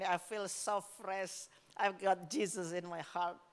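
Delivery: blissful. Retold or imitated. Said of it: "lalu dia bilang".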